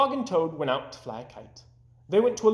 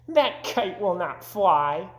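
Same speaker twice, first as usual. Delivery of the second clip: slow, higher sneering bird voice; mocking robins; 4 semitones higher, slower than usual. Robins in a bush jeering at Toad, slow and high. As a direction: drag it out to mock.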